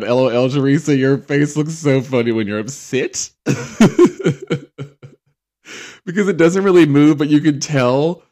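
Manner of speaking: loud, high, laughing delivery; helplessly amused, voice lifted; cracking up. Real, not acted. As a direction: talk through a fit of giggles. Cracking up at Teresa's crying face.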